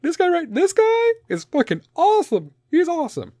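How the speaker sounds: high, loud gushing fan voice. Retold or imitated. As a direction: gush like an excited superfan. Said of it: "be like".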